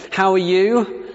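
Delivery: chirpy greeting. Retold or imitated. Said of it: "You know".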